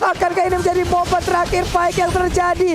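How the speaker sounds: building live esports play-by-play; rising hype commentary call; esports caster. Acted.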